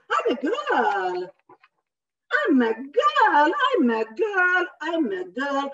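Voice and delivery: child protesting, hammed up; insistent girl.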